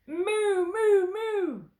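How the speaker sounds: high, loud wailing moos; imitated moo. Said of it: "the duck on the pond said".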